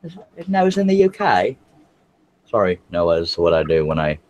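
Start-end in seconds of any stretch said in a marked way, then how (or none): 0.0-1.6 high-pitched mock British; squeaky comic British accent; put-on British accent. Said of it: "I'm always doing accents".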